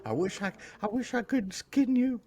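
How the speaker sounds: high, odd little killer voice; Ed Gein. Retold or imitated. Imitating a killer talking to his nurses, high creepy mock voice. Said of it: "And he's like".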